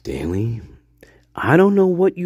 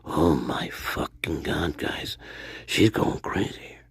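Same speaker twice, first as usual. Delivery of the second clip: whisper; 7 semitones lower, 9 dB quieter, slower than usual. Real, not acted. Awed aside to chat.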